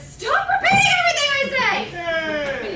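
high, loud, drawn-out comic outburst; exasperated shout. Acted. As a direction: yell it in fed-up exasperation. Bartender fed up with mimicking parrots.

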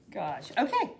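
usual speaking voice

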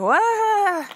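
high startled "What?"; shocked. Retold or imitated. Mom sees her son punching a friend, high startled cry. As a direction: jolt of alarm.

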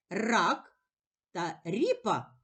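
normal talking voice

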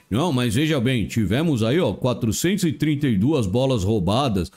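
low, evasive coach-speak voice; Rogério Ceni. Retold or imitated. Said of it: "os discursos do Rogério Ceni".

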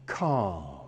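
low, drawn-out soothing calm. Shown as a demonstration. Trainer soothing his dog, low and drawn out. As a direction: settle the dog with one low word. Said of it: "projecting calm".

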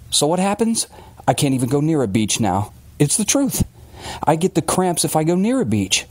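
lightly voiced aggrieved father; a touch of wounded self-justification; Billings, aggrieved storyteller.

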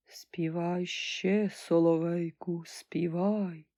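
slow, soft, breathy voice of Death; Death's whisper. Acted. Death speaks to nightingale. (шепіт Смерті)

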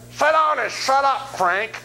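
high, loud, slow scolding wife voice; bossy wife. Retold or imitated. Wife telling her husband Frank to shut up, high and loud. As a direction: boss him down like a fed-up wife. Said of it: "and his wife said".

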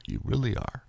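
almost lose the words to tears; choked quiet tears. Real, not acted. Vlogger repeating thanks to listeners, choked and quiet.

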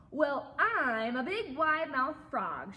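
slow, loud boast with swooping pitch; big-mouthed frog announcing himself loudly; shouted. A narrator's reading. Frog introduces himself to another animal, shouted. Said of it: "shouted the wide-mouthed frog".

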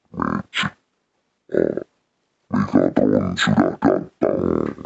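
deep, slow robotic villain voice; Megatron voice. Shown as a demonstration. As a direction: boom it out like a giant robot. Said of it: "kayak Transformer suaranya".

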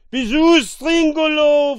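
very loud, high-pitched disbelief; astonished shout. A narrator's reading. Castaway recognising his lost crony, astonished shout. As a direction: call out in amazement. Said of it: "fragte er verwundert".

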